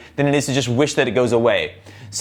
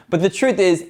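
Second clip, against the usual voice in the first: normal talking voice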